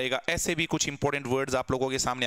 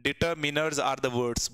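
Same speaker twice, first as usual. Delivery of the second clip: very slow dictation; much slower than usual. Shown as a demonstration.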